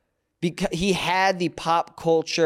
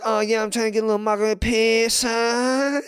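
hammed-up bad Italian gangster accent, pitched up; terrible Italian accent; 5 semitones higher than usual. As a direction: imitate a hopelessly fake Italian accent. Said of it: "his accent was terrible".

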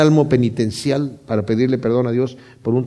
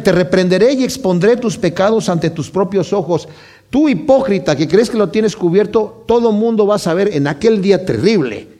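God's warning thundered out, louder and higher; preaching at full force; 5 semitones higher, 3 dB louder than usual. Acted. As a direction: proclaim the judgement like a rising storm. Da voz a Dios con fuerza. (predicador que da voz a Dios, advertencia tronante)

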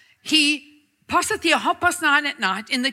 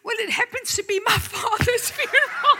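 laughing; 10 semitones higher than usual. Retold or imitated. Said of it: "So he said".

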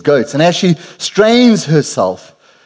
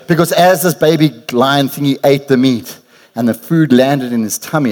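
ordinary delivery

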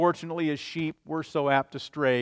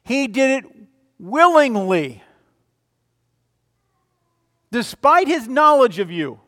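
higher, louder, slowed on "willingly"; preaching at full force; 4 semitones higher, 7 dB louder than usual. Acted. Pastor proclaiming Christ's willing death to the flock, fervent preaching. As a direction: ring out "joyfully" like a triumph.

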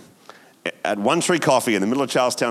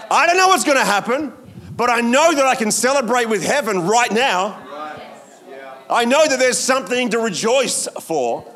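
higher, fervent pulpit build; rally the congregation to rejoice; preaching; 13 semitones higher, 4 dB louder than usual. Acted. Preaches with rising force.

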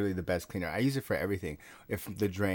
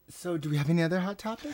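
higher, fast show host voice; bright talk show host; perky host voice; 8 semitones higher, faster than usual. Acted.

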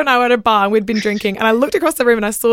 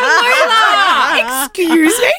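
drawn-out, higher indignant call of her name; mock outrage; 8 semitones higher, 4 dB louder, much slower than usual. Real, not acted. Calling out her best friend in mock outrage. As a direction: play scandalised at your friend.

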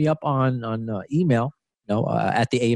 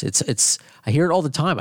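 usual speaking voice

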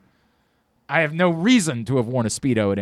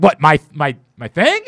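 mock alarm; 7 dB louder than usual. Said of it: "I was like".

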